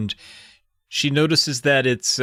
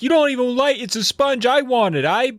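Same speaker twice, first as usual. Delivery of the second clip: put-on whiny kid; 8 semitones higher than usual. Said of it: "Daniel's like".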